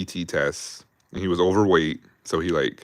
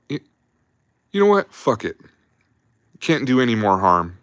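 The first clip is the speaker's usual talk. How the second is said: even higher and louder, exasperated giving up; fed-up sergeant; 5 semitones higher, 5 dB louder than usual. Retold or imitated. Sergeant gives up on the dumb private, high loud exasperation. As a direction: throw up your hands, it's hopeless. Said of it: "our sergeant looks at him and just goes".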